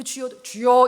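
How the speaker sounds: loud, composed call of "주여"; mock stately prayer cry; dignified invocation. Shown as a demonstration. Imitating a fine-sounding prayer, dignified call. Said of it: "이런 멋있는 주여도 아니죠".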